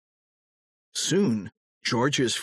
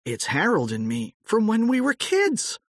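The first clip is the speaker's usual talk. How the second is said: eager explanation; 10 semitones higher than usual. A narrator's reading. Old George explains to wife.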